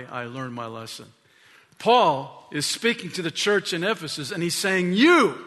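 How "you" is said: high, loud single word; attention-grabbing callout. Acted. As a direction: call out one listener to grab attention. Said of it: "He's getting our attention".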